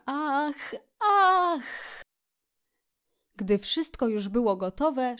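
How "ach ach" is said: high, drawn-out gasps of wonder; delighted exclamations. A narrator's reading. Mole unpacking the picnic basket, exclaiming at every find. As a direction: marvel at each new discovery. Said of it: "wykrzykując za każdym nowym odkryciem".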